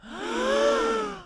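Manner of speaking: high gasp of mock horror; shocked gasp. Real, not acted. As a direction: gasp as if scandalised. Imagining parents' buttocks.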